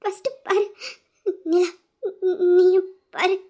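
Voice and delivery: broken, tearful urging; crying. Acted. Crying woman telling Veera and Nila to look, halting. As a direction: push each word out between sobs. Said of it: "அழுவ வேற செய்றாங்க".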